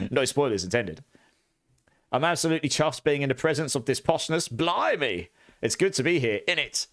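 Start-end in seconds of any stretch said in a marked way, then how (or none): 2.1-6.9 put-on playful Cockney accent, pitched up; mock Cockney. Chatter in a hammed-up Cockney accent. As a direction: lay on the Cockney geezer accent. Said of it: "It's not that posh".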